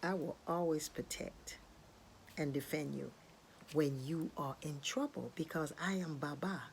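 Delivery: soft, low protective promise; solemn reassurance. Acted. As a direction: vow gently to keep someone safe. Obatala promises to protect bird.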